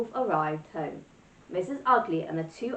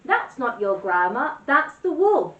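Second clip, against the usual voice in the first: louder, rising urgent warning; 5 semitones higher, 7 dB louder than usual. Acted. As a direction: warn her fast and hard. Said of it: "shouted Gertie".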